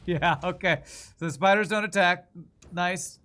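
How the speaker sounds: startled. Real, not acted. Surprised by spider.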